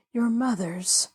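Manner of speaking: hushed, whispered. Acted. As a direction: let the words fall to a whisper. Pendleton's hushed confession.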